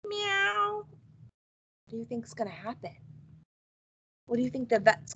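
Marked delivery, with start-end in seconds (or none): 0.0-0.9 meow. A narrator's reading. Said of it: "George went".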